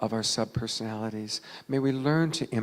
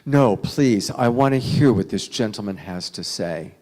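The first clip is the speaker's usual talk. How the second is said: raised, firm plea to be allowed to listen; summoning strength; 9 dB louder than usual. Retold or imitated. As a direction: plead firmly over the noise. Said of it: "summons the strength to say".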